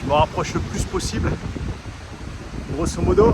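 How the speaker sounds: loud over gusty wind; shouting over wind. Real, not acted. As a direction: project hard against the wind. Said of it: "j'espère que vous m'entendez avec le vent".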